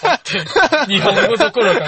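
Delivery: loud, high, incredulous laughing echo; laughing retort. Real, not acted. Host mocking co-host's dialect pick, laughing loudly. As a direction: echo it back, cracking up.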